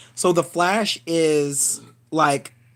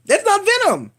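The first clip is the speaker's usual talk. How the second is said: "That's not Venom" jumped much higher in pitch; exasperated high repeat; 4 dB louder than usual. Real, not acted. Vlogger insisting to viewers, "That's not Venom" pitched high and exasperated.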